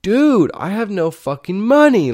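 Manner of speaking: high, loud exasperated outburst. Retold or imitated. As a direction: blurt it out in frustration. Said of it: "I'm like".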